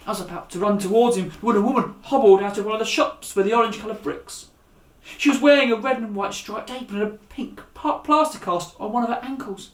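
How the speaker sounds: put-on lively read-aloud storytelling for children; animated children's story narration. A narrator's reading. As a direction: read it like a bedtime adventure. Reads the chapter aloud.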